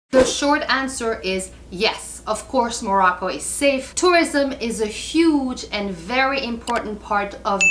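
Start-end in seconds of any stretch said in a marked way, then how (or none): none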